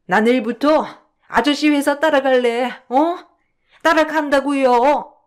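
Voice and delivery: put-on stamp your feet like a stubborn little girl; childlike tantrum. Mother refusing the caregiver and begging her son, childish tantrum. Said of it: "어린아이처럼 말이에요".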